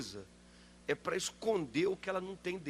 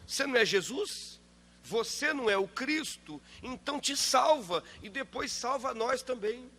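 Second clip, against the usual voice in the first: higher, demanding mock taunt; insolent thief; 7 semitones higher than usual. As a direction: mimic the thief's cheeky demand. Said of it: "a ousadia desse homem".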